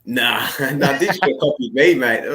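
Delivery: amused, incredulous comeback; laughing retort. Real, not acted. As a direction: tease through a laugh. Joking retort, laughing with host.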